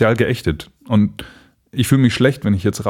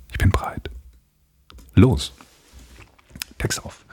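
whispered; 4 dB quieter than usual. Real, not acted. Whispered before reading.

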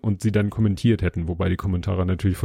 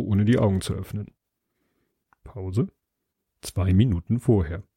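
plain reading voice

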